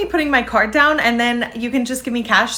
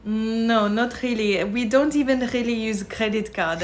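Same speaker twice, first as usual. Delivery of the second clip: put-on softer, casual French accent; 4 dB quieter than usual. Frenchwoman dismissing credit cards to tourist, French accent. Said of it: "a very impressive French accent".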